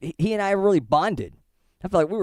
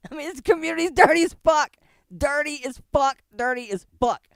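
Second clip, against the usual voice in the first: exclaiming; 10 semitones higher than usual. Real, not acted. Vlogger to viewers, exclaiming high in disbelief.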